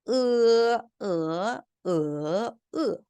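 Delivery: 으 level, rising, dipping, then falling; four-tone drill. Shown as a demonstration. Teacher letting students hear four tones on 으, level, rising, dipping, falling. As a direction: chant 으 through high level, rise, dip, drop. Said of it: "성조 사 성을 붙여서 한번 읽어볼게요".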